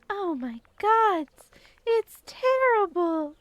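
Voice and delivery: high, loud, drawn-out alarm; panicked slow cry. Acted. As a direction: wail it out in shock. NPC panics over geyser.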